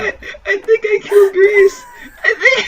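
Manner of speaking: laughing through the words; cracking up mid-sentence; laughing hard. Real, not acted. Laughing fit at joke.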